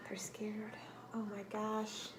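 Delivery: a teacher's dramatic whisper to the class. Acted. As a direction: whisper it like a scary secret. Hushed aside to the children.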